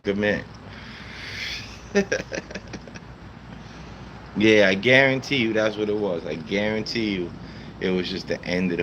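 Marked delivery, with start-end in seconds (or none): 0.5-4.3 long, soft wheeze of laughter. Real, not acted. Streamer amused by chat's pause, soft laugh. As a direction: laugh quietly, mostly on breath.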